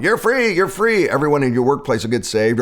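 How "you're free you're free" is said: higher, cheery chant of "you're free"; sing-song it at coworkers like a naive preacher; mock proclaiming. Pretending to preach freedom at coworkers, hammed-up and higher. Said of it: "just go to work and start going".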